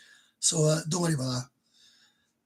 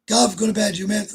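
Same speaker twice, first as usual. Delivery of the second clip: loud call, higher, louder; calling out to Gav; 4 semitones higher, 6 dB louder than usual. Retold or imitated. Translating for Gav, telling him loudly to go to bed. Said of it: "I was translating".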